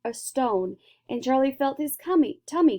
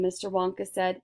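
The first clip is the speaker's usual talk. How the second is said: plain reading voice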